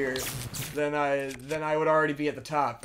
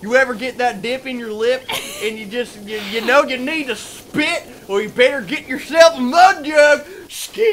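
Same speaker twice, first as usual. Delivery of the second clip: loud, drawn-out country DJ shouting; 14 semitones higher, 18 dB louder, slower than usual. Acted. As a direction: hype it like a backwoods radio ad. Hick radio ad parody.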